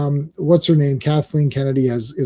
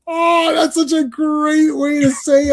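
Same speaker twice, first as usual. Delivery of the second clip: gushing; 9 semitones higher than usual. Retold or imitated. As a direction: act amazed and charmed. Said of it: "I was like".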